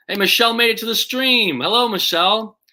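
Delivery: high, loud cheery shout-out; excited greeting. Real, not acted. Streamer welcomes a viewer to the stream, excited greeting.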